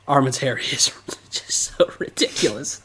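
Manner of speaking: laughing through the words; cracking up. Real, not acted. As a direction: try to get it out while giggling. Cracks up over Armin's hair.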